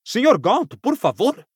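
high-pitched, shocked protest; appalled, high appeal; shocked plea. A narrator's reading. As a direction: plead in shock, voice jumping up. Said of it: "pediu Ogden em tom chocado".

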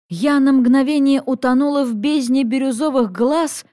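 usual speaking voice

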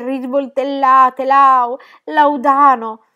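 sempre più forte e acuta, fino al grido; accusa disperata. A narrator's reading. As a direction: rinfacciagli tutto, crescendo. Gigi accusa Gaston, disperata. (ragazza disperata che accusa l'innamorato, in crescendo)

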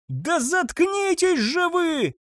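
high, slow yell. A narrator's reading. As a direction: bellow at them to shut up. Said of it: "завопил Густав". (высокий протяжный крик)

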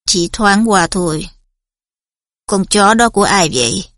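low, cold and measured teacher; stern Snape voice. A narrator's reading. Snape voice held.

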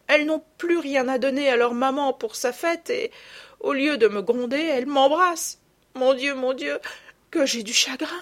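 put-on high, distressed little girl; a child pouring out her guilt; tearful confession. Marguerite tearfully confesses to her mother what she did, high voice.

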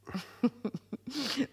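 short high amused laugh; mocking laugh. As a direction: laugh off the very idea. Marquise lacht über Madame Chardon. (spöttisches, helles Auflachen)

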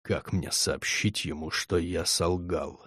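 low slow voice from the grave; menacing quiet deathly question; cold hissing layered whisper. A narrator's reading. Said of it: "заговорил тихим загробным голосом".

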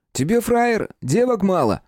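high-pitched aggressive taunt; heated, high street challenge; angry challenge. A narrator's reading. Eller provokes a fight.

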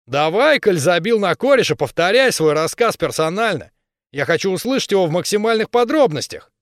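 high, loud indignant demand; indignant berserker. Acted. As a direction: demand it like an offended thug. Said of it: "он возмутился".

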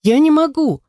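high-pitched pleading in despair; desperate plea. A narrator's reading. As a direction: beg desperately, climbing to a high cry on the last words. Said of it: "В отчаянии воскликнул Нед".

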